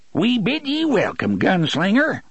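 high-pitched, excited rustic greeting; cried welcome. A narrator's reading. Si greets the gunslinger, hat held to chest, crying out. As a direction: cry it high, full of reverent excitement. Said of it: "he cried".

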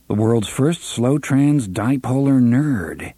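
ordinary delivery, nothing marked

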